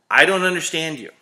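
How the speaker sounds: exasperated outburst. Acted. Exasperated at customers who leave.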